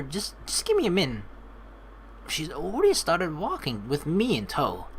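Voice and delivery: normal talking voice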